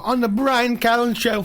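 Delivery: high, slow announcer-style correction; mock announcer correction. Guest correcting the host's flubbed show name, hammed announcer.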